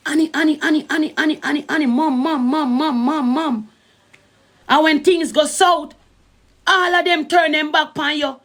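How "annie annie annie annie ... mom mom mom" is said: high, rapid repeated name-calling; mock everyone pestering you, word after word; mimicked clamoring for attention. Mimicking people crowding round when money runs, mock pestering. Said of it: "all of them did it".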